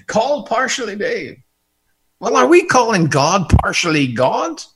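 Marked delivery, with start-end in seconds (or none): 0.1-1.4 put-on higher-pitched go at a Kerry villager accent; retry the County Kerry accent, pitched up; second accent attempt. Villager recommending the handyman, higher attempted Kerry accent. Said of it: "That's not the right accent".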